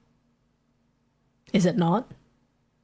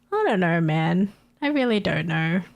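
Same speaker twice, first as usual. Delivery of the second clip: usual speaking voice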